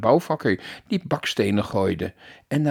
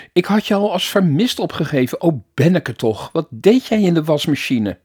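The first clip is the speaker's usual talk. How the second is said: higher, louder, still wound up; excited mother; 5 semitones higher, 6 dB louder than usual. A narrator's reading. Mother scolding and relieved at her son. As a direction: keep the relieved mother going.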